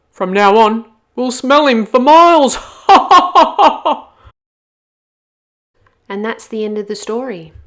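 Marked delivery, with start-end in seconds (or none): 0.1-2.6 booming gloat. Acted. Rambo gloats over the wolf. 2.6-4.0 booming ram laugh. Acted. Rambo laughs.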